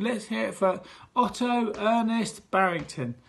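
milk the crowd for applause; head teacher leading applause like a host; showbiz applause call. Acted. Host announcement.

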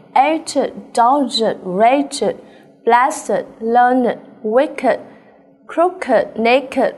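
two-syllable "crooked", "naked", "learned", ending in "id"; ED as extra syllable. Shown as a demonstration. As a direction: read "aged, wicked, naked" with -ed as its own "id" syllable. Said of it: "tất cả các âm E D ở đây đều được đọc là".

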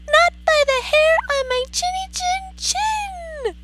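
very high squeaky nervous piggy voice; nervous little pig. Acted. Pig answering the wolf, high nervous squeak. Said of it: "replied the pig nervously".

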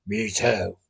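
low, soft growl. A narrator's reading. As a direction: growl it like the dog. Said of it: "a voice so close to a growl".

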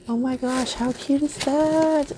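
high-pitched cooing delight; really excited. Real, not acted. Crafter admiring her friend's handmade card, excited. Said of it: "Sorry, I'm really excited".

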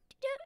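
high, soft two-note chirp; imitated answering bird. Woman imitates the other bird replying, high soft chirp. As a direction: answer like a second little bird. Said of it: "other one would be like".

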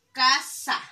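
casa split loudly into ca, sa; firm, separated ca-sa; syllable by syllable. Shown as a demonstration. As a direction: sound out casa in two beats. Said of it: "Y decirlas por, eh, sílabas".

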